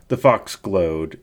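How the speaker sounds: ordinary delivery, nothing marked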